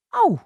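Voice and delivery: high, loud yelp of pain; ouch cry. Acted. Mr Drill is pulled over by the dog, high loud ouch. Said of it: "roept meneer Drill".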